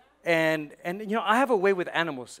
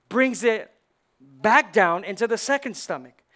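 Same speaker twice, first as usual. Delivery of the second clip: loud, rising preacher's build-up; preaching; 3 semitones higher, 5 dB louder than usual. Acted. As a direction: preach it to the congregation with fire. Preaches about the cud.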